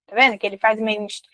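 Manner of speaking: ordinary delivery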